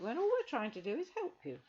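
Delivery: put-on high, outraged young girl; be shocked at her ingratitude; girl indignant at the ungrateful witch; indignant Rosemary.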